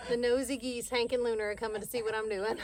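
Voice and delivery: ordinary talk, nothing marked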